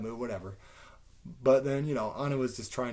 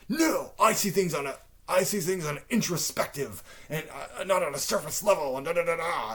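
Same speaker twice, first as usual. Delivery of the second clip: raised, louder imitation of a self-important objector; mock indignant reply; 5 semitones higher, 4 dB louder than usual. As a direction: mimic someone insisting on his own depth. Mimics man's pompous indignant reply.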